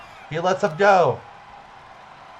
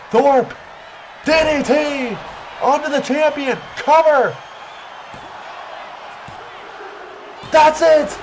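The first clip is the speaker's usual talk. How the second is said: climactic shout; 10 semitones higher, slower than usual. Acted. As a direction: scream the finish like a commentator. Match-ending pin call.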